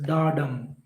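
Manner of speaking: low "da dum", weight on "dum"; light "da" into a held "dum"; stress on dum. Shown as a demonstration. Teacher recapping iamb, stress on "dum".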